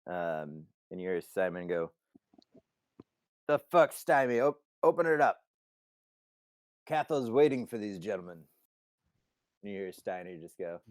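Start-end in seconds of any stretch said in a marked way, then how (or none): 3.5-8.5 raised voice calling upward; loud, higher-pitched annoyed demand; irritated shout. Acted. Simon to Steiny behind the grate, irritated shouting.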